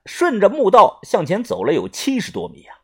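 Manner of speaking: usual speaking voice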